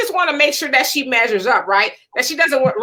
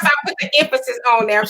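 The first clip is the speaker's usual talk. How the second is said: ordinary talk, nothing marked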